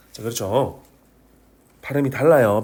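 ordinary talk

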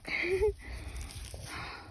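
soft, breathy high laugh. Real, not acted. Vlogger telling viewers how hot it is, soft laugh. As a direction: laugh it off quietly.

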